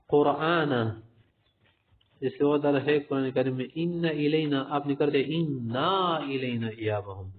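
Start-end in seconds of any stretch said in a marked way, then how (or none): none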